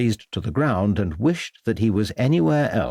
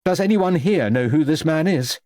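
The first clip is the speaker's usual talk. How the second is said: calling question; 7 semitones higher than usual. A narrator's reading. Vicar asks the crowd.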